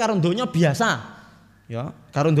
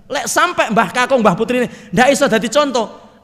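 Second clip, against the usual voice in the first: raised warning; 8 semitones higher, 8 dB louder than usual. Real, not acted. Preacher warns grandparents, voice rising.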